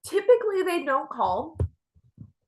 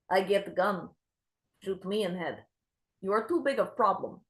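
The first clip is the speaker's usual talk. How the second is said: put-on weary Ukrainian accent; 4 dB quieter than usual. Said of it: "she has an accent like this".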